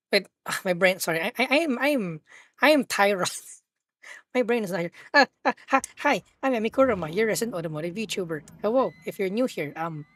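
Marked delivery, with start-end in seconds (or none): none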